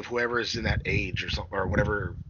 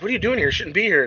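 surprised scolding; 8 semitones higher, 4 dB louder, faster than usual. Retold or imitated. Character voiced in movie retelling.

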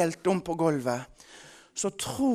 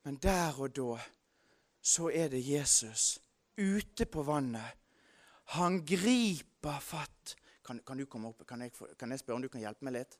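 much softer, lower pastoral voice; intimate preaching; 4 semitones lower, 7 dB quieter than usual. Acted. Preacher consoling listeners about hard news, soft and low. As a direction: draw them close and speak gently. Quiet pastoral application to congregation.

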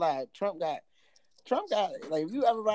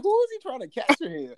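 mocking high voice; 12 semitones higher, 5 dB louder than usual. Mocking trainer's flashy look.

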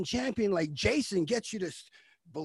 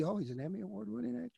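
mock onlooker; 3 semitones higher, 13 dB quieter, much faster than usual. Mock what people say.

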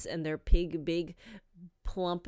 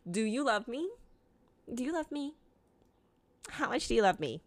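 softer, higher clingy asking; needy pleading; 5 semitones higher, 4 dB quieter, faster than usual. Retold or imitated. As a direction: whine for reassurance again. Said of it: "I'll literally ask that three times a day".

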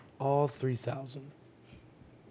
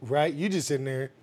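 ordinary talk, nothing marked

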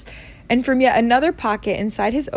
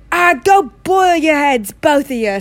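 angry dismissal; 5 semitones higher, 6 dB louder than usual. A narrator's reading. Hagrid silencing both Dursleys, angrily dismissive. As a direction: tell them off loudly.

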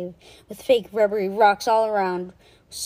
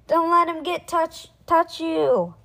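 high-pitched, raised warning voice; urgent warning; 8 semitones higher, 5 dB louder, slower than usual. A narrator's reading. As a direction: keep calling out the danger. Boy warns friend of monster.

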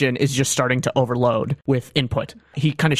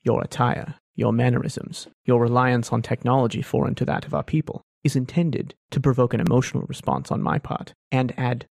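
smiling Thrawn deduction. Acted. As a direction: purr through the deduction, calm and knowing. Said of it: "he smiles a little bit ... and says".

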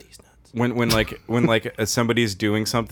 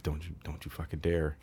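retold quiet warning; 6 semitones lower, 13 dB quieter than usual. Parent warning tearful child, retold.

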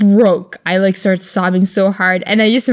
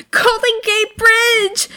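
high, slow, gushing Golden Gate Bridge; mock excitement; 13 semitones higher, much slower than usual. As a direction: squeal it like an overexcited tourist. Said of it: "all the three of you were like".

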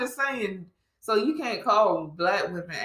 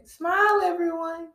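mock overseer; 12 semitones higher than usual. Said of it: "people on the plantation".

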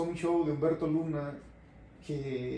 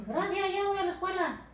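put-on high little boy voice, chirpy; child voice. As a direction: sound like a small schoolboy. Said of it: "Pues yo soy la voz de un niño".

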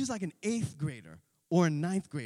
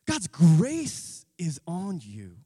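"God's grace is on you" slow and loud; slow emphatic; 8 dB louder, much slower than usual. Shown as a demonstration. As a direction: press every word home slowly. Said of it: "think of it this way".

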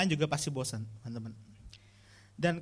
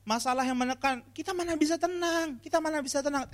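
much higher, nagging complaints to Bill; whiny complaining youth; 8 semitones higher than usual. Retold or imitated. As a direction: whine like a bored teen stuck at home.

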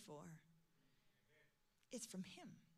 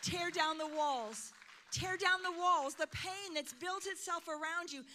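louder, higher, impassioned preaching; full-force preaching; 7 semitones higher, 9 dB louder than usual. Acted. Preacher urging the congregation to open up, at full force.

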